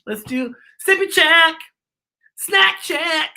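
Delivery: high, bright call-out; excited cheer. Acted. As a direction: hype up the snack check. Cheering kids through snack check.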